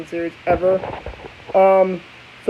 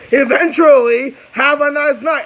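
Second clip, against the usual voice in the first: high, mocking farewell; a touch of taunting driver; mean sendoff; 6 semitones higher, 3 dB louder, slower than usual. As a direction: sneer it sweetly as you leave. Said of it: "she goes right into being a dick".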